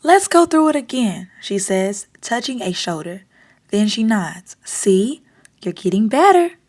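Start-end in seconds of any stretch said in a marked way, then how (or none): none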